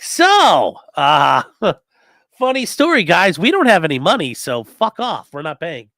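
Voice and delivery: mock defiant shout. Lawyer voicing the city telling the counties it won't pay, loud. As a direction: shout it at the other counties like a broke bully.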